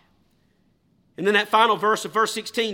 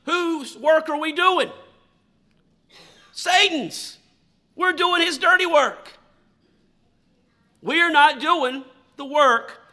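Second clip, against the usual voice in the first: higher, louder, slow and building; fiery preaching; 8 semitones higher, 5 dB louder, slower than usual. Acted. Preacher confronting the congregation about Satan's work, rising fiery preaching. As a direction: drive the question home with fire.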